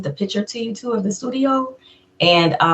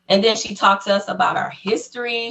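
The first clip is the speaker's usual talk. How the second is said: normal talking voice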